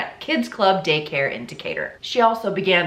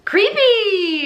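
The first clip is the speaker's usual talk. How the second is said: high, loud, drawn-out "creepy"; mock-creepy call; 13 semitones higher, 5 dB louder than usual. Real, not acted. Host teasing the subject, mock-creepy. Sing-song jab after the intro.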